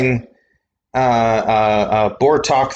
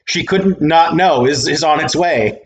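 usual speaking voice

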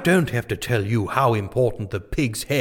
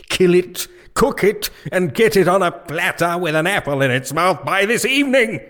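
royal command; 5 semitones higher than usual. Acted. King giving royal order.

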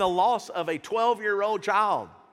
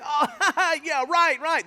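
high, loud fake laugh into a scoff; acted-out jeering laughter; mocking laughter; 7 semitones higher, 5 dB louder, much slower than usual. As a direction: laugh derisively, dismissing it. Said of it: "when they begin to laugh".

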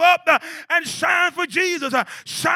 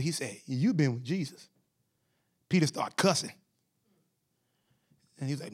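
much softer, lower, intimate preaching voice; low, confiding preacher telling the Peter story; hushed sermon storytelling; 12 semitones lower, 12 dB quieter, faster than usual. Acted. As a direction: draw them in close with a quiet retelling. Quiet storytelling stretch of sermon.

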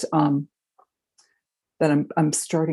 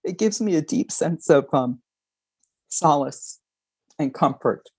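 tearful. Real, not acted. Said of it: "makes me wanna cry".

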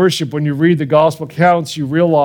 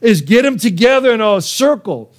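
raised, ringing exhortation to the congregation; preaching at full force; 5 semitones higher than usual. Acted. Pastor urging parents and grandparents to worship, preaching fervently. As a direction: preach it out with fervour.

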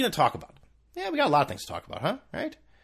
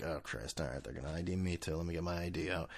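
muttered to self; 8 semitones lower, 15 dB quieter than usual. Retold or imitated. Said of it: "I was like".

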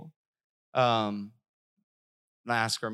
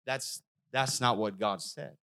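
ordinary delivery

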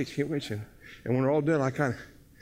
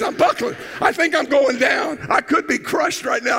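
mock panicked shout; 7 semitones higher, 4 dB louder than usual. Retelling buckling under heavy man.

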